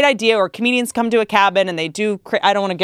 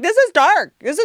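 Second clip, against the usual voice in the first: mock Leah Remini; 9 semitones higher than usual. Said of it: "She was like".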